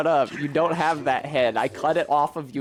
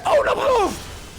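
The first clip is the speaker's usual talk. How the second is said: a sudden high yelp of alarm; startled high cry; slower than usual. Real, not acted. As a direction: yelp as the danger hits. Sudden danger in the game.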